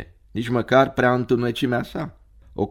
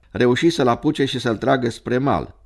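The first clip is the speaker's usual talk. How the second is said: usual speaking voice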